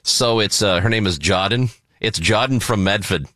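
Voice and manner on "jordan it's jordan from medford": dropped Rs like a Bostonian; Boston accent. Retold or imitated. Host mimicking how a friend says her name, Boston accent. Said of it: "That's how she says it".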